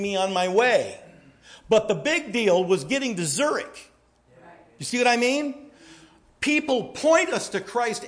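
high, loud preaching to the congregation; impassioned preaching. Acted. Pastor urging his congregation, high and loud. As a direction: drive the point home from the pulpit.

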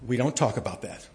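a hint of a stiff mother ending the topic; quoting mom. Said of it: "That's what she used to say".

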